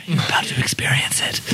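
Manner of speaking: ominous hushed whisper. Acted. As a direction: whisper it like a threat. Ominous aside.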